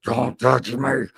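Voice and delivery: low, slow growl of a warning. Retold or imitated. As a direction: imitate a strange voice forced out mid-panic. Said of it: "where'd that voice come from?".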